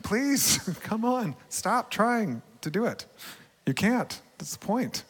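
a lightly voiced father gently begging his child; pleading tenderly. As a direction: coax like a loving father. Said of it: "And he was like".

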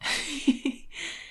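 a short airy giggle; quiet amused giggle; high breathy laugh. Real, not acted. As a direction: laugh softly at the joke. Laughing at joke.

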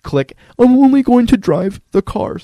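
put-on high, loud, slow pleading kid's sales pitch; kid pitching parents. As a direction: sell it like a kid begging for a game. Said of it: "I was like".

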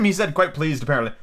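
ordinary delivery, nothing marked

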